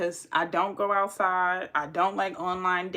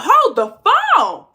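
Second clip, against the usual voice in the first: high, loud and slow, stunned disbelief; shocked outburst; 11 dB louder than usual. Retold or imitated. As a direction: stop him cold, loud and high. Shocked reply to his demand.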